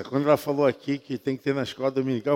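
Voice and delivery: usual speaking voice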